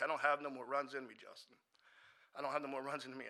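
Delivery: normal talking voice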